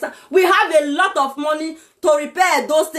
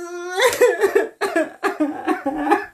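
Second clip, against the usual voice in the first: a tearful laugh breaking out; half laugh, half sob, let it spill; 3 dB quieter than usual. Real, not acted. After calling for the boys, a tearful laughing sob.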